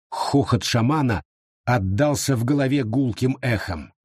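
ordinary delivery

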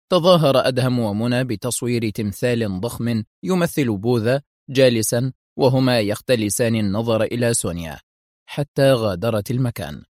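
usual speaking voice

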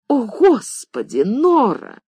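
slow, high, drawn-out exasperated cry. A narrator's reading. Mistress brushing off maid's theory, exasperated. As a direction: wave her off, annoyed. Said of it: "сердито, нетерпеливо отмахнулась".

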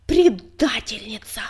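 slow, loud furious accusation; choking with rage. A narrator's reading. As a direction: spit the word out in fury. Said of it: "захлебнулся от злобы".

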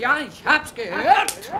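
high, loud and slow, barely held anger; mother scolding her boastful son; angry mother. Acted. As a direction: let the fury build before the slap. Mother about to slap her son.

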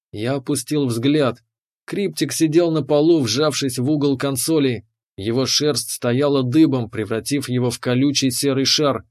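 ordinary delivery

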